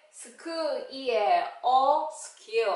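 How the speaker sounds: "스크, 이에, 어" stretched apart, then "스킬" joined; phonics blending. Shown as a demonstration. Teacher reading SK words, slow sound by sound. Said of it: "에스케이 글자로".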